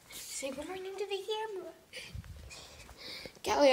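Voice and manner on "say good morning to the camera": high, soft voice for the dog. Real, not acted. Talking to the dog in baby voice.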